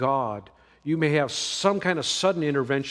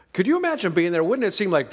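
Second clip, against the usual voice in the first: ordinary talk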